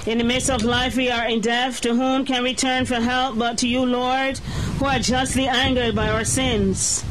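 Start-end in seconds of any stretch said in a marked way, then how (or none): none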